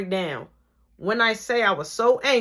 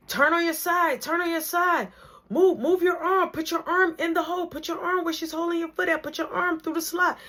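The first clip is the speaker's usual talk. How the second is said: coaching instructions; 10 semitones higher than usual. Retold or imitated.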